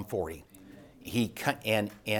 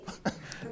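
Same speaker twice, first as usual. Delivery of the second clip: breathy laugh; 10 dB quieter than usual. Real, not acted. Laughs after the squeal.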